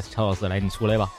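usual speaking voice

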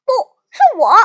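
loud roared objection; bellowed, competitive retort; roaring. A narrator's reading. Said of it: "狂吼起来".

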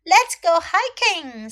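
high, loud, eager invitation; excited suggestion. Acted. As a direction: invite your friend along with excitement. Maud happily proposes a hike.

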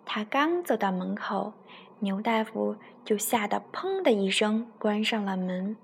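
put-on storytelling voice for a child; story narration. A narrator's reading. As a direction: read it like a bedtime story to a little one. Mother reads bedtime story. (给孩子讲故事的语气)

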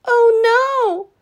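high, loud cry of dismay; cried. Acted. Danny and Betty hearing the judging is soon, high loud alarm. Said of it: "cried Danny and Betty".

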